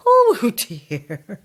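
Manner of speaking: high giggly oh dear; laughing at her own slip. Acted. Old lady laughs at her innuendo.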